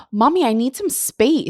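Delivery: put-on high, loud, slow child demand; imitating little son. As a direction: announce it slowly like a small kid. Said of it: "And he says".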